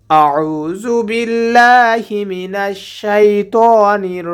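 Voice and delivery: slow, drawn-out chanted recitation; slow Quran recitation. Shown as a demonstration. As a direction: recite each word slowly and melodically. Teacher recites taawwuz and bismillah. (ধীর সুরেলা তিলাওয়াত)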